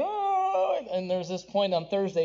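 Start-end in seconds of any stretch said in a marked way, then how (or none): 0.0-0.8 high mock wail of a fussing infant; imitating baby cry. Father imitating his infant son's cry, high mock wail. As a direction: squeal it like a crying baby. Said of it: "he's maybe crying".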